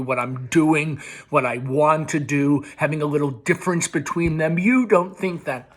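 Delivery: loud, pushing back hard; angry son defending himself loudly; heated son. Acted. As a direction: argue back, fed up. Son argues back with mother.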